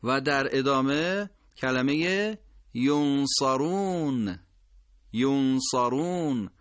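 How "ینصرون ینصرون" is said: ینصرون slow, first vowel rounded; careful recitation; ينصرون with o. Shown as a demonstration. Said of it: "چرا این مهمه".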